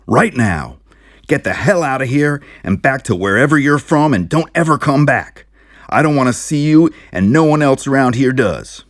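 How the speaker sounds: loud, high angry shouting; furious shouting. Acted. Sheriff shouting at the strangers to leave for good. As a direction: drive them out with every word.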